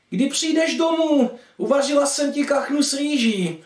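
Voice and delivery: high-pitched mum calling her son home; mother's voice. Acted. Mother asks son to come home. (vysoký mateřský hlas)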